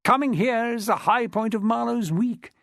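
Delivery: put-on lightly posh English public school accent. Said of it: "in an accent redolent of one of the better public schools".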